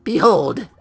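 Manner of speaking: a lightly voiced girl building to a loud cry; announcing girl. Player voicing a girl about to tell a joke, building to a loud call. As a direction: announce the joke, then belt Behold. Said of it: "She takes the stage again".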